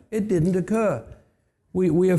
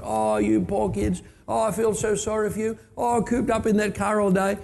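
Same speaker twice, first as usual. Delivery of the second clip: mock pity; 6 semitones higher than usual. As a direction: imitate a pastor fussing over the kids. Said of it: "and he starts going".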